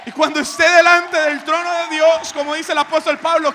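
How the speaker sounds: loud, high, drawn-out pulpit shout; preaching at full force. Acted. Pastor telling the church Jesus paid their debt, soaring shout. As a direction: lift it up, the grace is paid.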